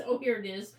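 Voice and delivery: ordinary delivery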